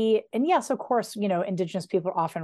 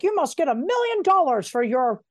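mocking snob voice; 4 semitones higher, 5 dB louder than usual. Mocking literary people's question.

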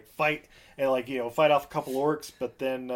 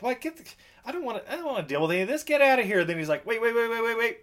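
high, rushed mock irritation; impatient villain; 9 semitones higher, faster than usual. Villain waving off prisoners then stopping them, high and rushed. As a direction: rattle it off like a bored tyrant. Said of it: "and he's like".

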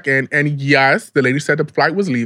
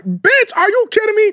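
much higher, squealing disbelief; outraged disbelief. Real, not acted. Scoffing at the flight price to her friend, squealing much higher. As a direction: squeal it up high in mock outrage.

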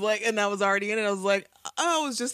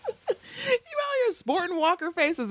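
mock outrage; 13 semitones higher, slower than usual. Mock outrage at zombie masks.

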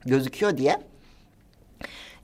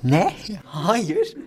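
mock clueless denial, higher at the end; feigned incomprehension; 3 semitones higher than usual. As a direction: play dumb when accused. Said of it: "Duymam ve anlamazlıktan falan geliyorum".

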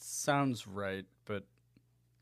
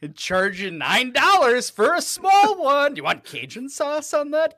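much higher, louder hammed-up cashier, shouting prices; mocking the fries prices as an overeager employee; 10 semitones higher, 10 dB louder than usual. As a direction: bellow like a pushy burger-joint cashier. Mocking a fast food cashier.

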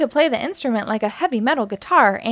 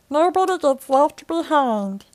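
higher, louder, rough little alien voice; Stitch voice; 4 semitones higher, 3 dB louder than usual. Acted. Alien answering the girl he saved, in creature voice. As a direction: growl it out like a small alien creature.

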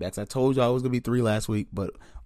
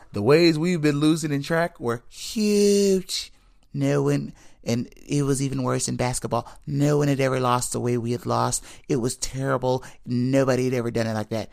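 Trump impression; 3 semitones higher than usual. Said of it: "sorry, Trump".

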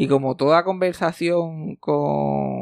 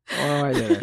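amused laughing retort; laughing through it. Retold or imitated. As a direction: repeat it while laughing. Repeat of joke, laughing.

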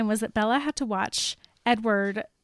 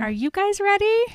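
excited; 10 semitones higher, 4 dB louder than usual. Real, not acted. Host hypes listeners.

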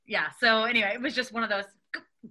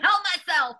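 normal talking voice

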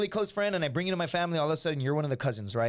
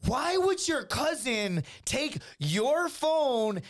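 high, very slow interrogation; retold incredulous girlfriend; 8 semitones higher, much slower than usual. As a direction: spell out the question in stretched-out disbelief. Girlfriend doubts the excuse.

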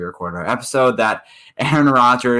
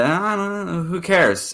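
lightly voiced, pitched-up imitation of a dismissive GM; Gettleman impression; 9 semitones higher, slower than usual. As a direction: toss it off high and careless, like a shrugging GM. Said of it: "And Gettleman said".

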